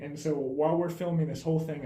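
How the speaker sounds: usual speaking voice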